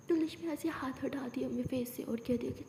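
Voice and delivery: plain reading voice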